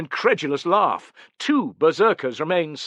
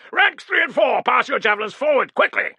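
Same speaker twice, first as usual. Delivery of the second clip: shouting orders; 9 semitones higher than usual. Acted. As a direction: hurry the rear ranks. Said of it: "Caedicius was shouting".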